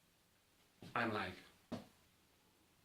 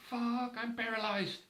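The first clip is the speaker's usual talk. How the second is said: panicked realization; 8 semitones higher than usual. Retold or imitated. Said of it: "I'm like".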